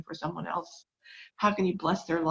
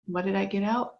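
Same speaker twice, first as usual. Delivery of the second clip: ordinary talk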